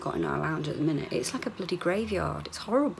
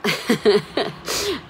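loud laugh; 3 semitones higher, 7 dB louder than usual. Real, not acted. Laughs at morning.

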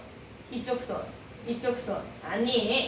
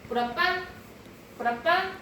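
talking to a dog; 7 semitones higher than usual. Real, not acted. Commanding the dog to play dead.

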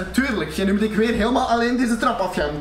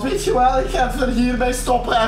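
ordinary talk

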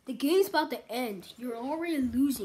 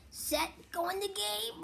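drawn-out high announcer call; game announcer; 8 semitones higher, 4 dB louder, much slower than usual. Pretend. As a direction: stretch it like an arena announcer. Calling the game start like announcer.

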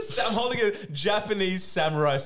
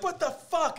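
much higher shocked outburst; shocked exclamation; slower than usual. Retold or imitated. Miss Love seeing the eel pieces move, high shocked cry. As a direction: recoil at something still moving. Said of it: "That was where I was like".

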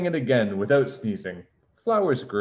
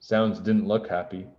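ordinary delivery, nothing marked

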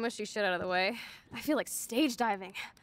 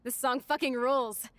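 usual speaking voice

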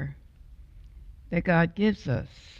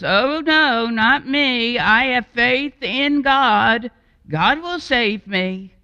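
higher, louder smug refusal; self-righteous refusal; 7 semitones higher, 5 dB louder than usual. Acted. Homeowner refusing the jeep driver, smug and self-righteous. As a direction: turn help down with pious pride. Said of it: "in his self-righteous mode says".

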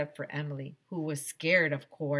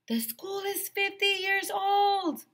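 high, slow yell downward; high slow man yelling down; yelled into the hole; 4 dB louder, slower than usual. A narrator's reading. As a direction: shout down to her below. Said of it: "Mister Harrison yelled into the hole".

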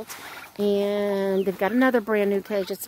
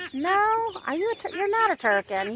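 surprised question; 8 semitones higher than usual. Real, not acted. Surprised at a bird.

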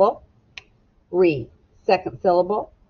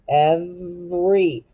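every drawn out slowly; stretched word; much slower than usual. Shown as a demonstration. Teacher stretching the word for children to map. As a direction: stretch out every so each sound is heard. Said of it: "We hear the V".

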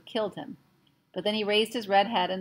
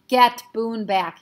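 slow, loud urgent call; shouting; 2 semitones higher, 7 dB louder, much slower than usual. Acted. Omri shouting to Patrick, loud and slow. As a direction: shout the order across the room. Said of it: "he shouted".

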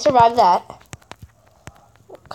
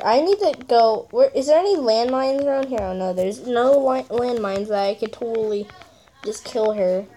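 usual speaking voice

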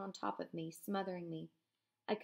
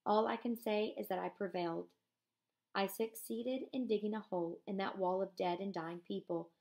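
ordinary talk, nothing marked

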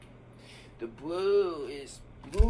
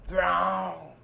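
proud, loud colour call; loud answer; 6 dB louder than usual. Pretend. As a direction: call out brown louder. Kid repeats the colour answer.

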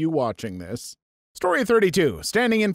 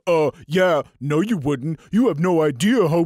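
put-on know-it-all commenter voice; mock an objecting commenter, louder at the start and end; 4 dB louder than usual. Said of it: "someone in the comments wants to come in and say".

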